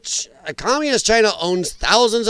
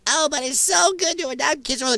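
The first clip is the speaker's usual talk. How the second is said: a higher mock voice, sing-song and sneering; mocking lefty; 8 semitones higher than usual. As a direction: parody the do-gooder's whine. Mocking what lefties say.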